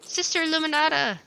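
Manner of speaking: loud, high call across a room. Acted. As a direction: call her name loudly. Calling out for the nun.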